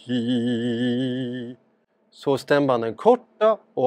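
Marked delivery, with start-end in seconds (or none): 0.1-1.6 a low, relaxed hi. Shown as a demonstration. Doctor shows a low tone on camera, low relaxed hi. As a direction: drop the pitch low and let the hi sit there. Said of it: "Om jag tar en låg ton".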